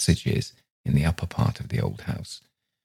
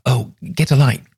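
higher, louder, urgent plea; urgent request; 8 semitones higher, 4 dB louder than usual. A narrator's reading. Aunt to nephew fumbling with matches, urgent. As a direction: hurry someone along, voice pitched up. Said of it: "she added".

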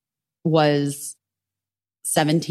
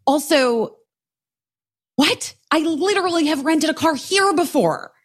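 high, exasperated retold outburst; retold anger; 8 semitones higher than usual. Customer fuming at rental clerk over refusal, retold angry. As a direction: act out indignant disbelief, pitched high. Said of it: "I got fucking angry".

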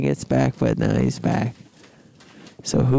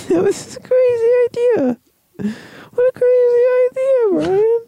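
very high, loud comic squeal; squealing rant; 4 dB louder than usual. Acted. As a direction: squeak it out hysterically.

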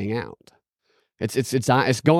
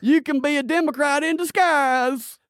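put-on Southern accent; 5 semitones higher than usual. Mocking Georgia talk up to "Go Dogs", exaggerated Southern accent. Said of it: "you talk like this enough".

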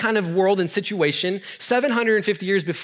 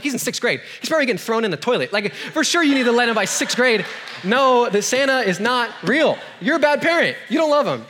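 building to a half-laughing shout; mock scolding; 6 semitones higher than usual. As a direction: lecture the parents with mock indignation. Retorting to the parents.

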